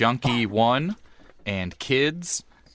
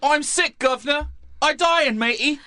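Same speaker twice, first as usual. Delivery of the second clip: much higher Cockney whine; Cockney junkie. Acted. Junkie by the fire telling his mate he's dying, high Cockney. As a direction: whimper like a sick London junkie.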